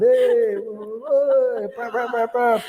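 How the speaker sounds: mock road rage with horn sounds; shouting driver. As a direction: yell and honk like an angry driver. Other driver shouts and honks.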